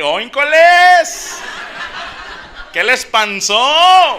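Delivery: high, loud, slow drawn-out shout; mock yell. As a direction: holler it across the party, teasing. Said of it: "Sí les grité". (grito burlón, agudo, fuerte y lento)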